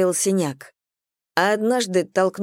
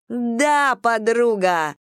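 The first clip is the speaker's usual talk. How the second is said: narrated yell, kept in check; mild whoop; 5 semitones higher, much slower than usual. A narrator's reading. Cam cheering her friend on, understated whoop. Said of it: "с воплем".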